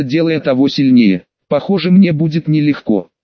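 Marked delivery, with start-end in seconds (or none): none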